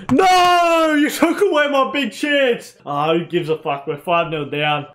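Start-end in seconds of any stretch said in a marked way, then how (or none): 0.1-2.8 frustrated gamer shouting no; anguished shout. Real, not acted. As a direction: yell at the screen as the chance is stolen. Chance snatched away in game.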